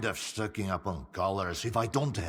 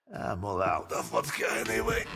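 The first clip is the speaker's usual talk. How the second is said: ordinary talk, nothing marked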